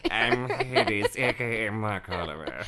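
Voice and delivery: drawn-out impersonated villain voice; Mark Oliver. Acted. Voice actor shows his Hades, hammy impersonation. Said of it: "me doing my Mark Oliver impersonation".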